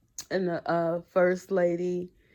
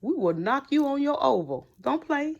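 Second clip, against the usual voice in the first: heated clapback; 4 semitones higher than usual. Retold or imitated. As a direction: square up to the president, half joking. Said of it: "I kinda got in my feelings".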